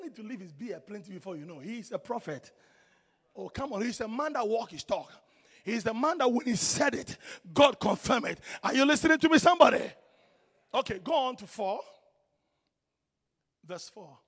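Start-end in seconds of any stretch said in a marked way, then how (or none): 5.6-9.8 loud, climbing challenge to the congregation; fired-up pulpit call; rousing preaching. Real, not acted. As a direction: build to a raised demand for attention. Preaches at full force.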